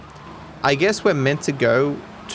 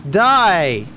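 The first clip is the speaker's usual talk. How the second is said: loud higher shout at the enemy; yelled die; 4 semitones higher, 6 dB louder than usual. Real, not acted. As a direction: bark the order to die. Attacking enemy.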